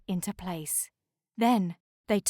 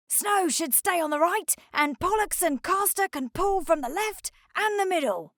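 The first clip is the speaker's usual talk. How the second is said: higher, confident directing voice; Harry giving orders; 9 semitones higher, 3 dB louder than usual. Acted. Harry assigning the icebreakers their towing positions, higher and commanding. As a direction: point everyone into place.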